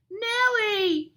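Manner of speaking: high, excited call; high-pitched storybook shout. A narrator's reading. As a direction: call out the name to a friend. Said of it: "he shouts".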